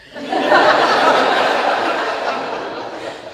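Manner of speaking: high, loud burst of laughter. Real, not acted. Friend remembering college nights, bursting into loud laughter. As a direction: laugh out loud at the fond memory. Fond laugh recalling her drinking.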